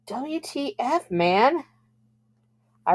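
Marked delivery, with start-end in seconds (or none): 0.1-1.6 raspy stage whisper; hoarse whisper. A narrator's reading. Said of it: "whispered hoarsely".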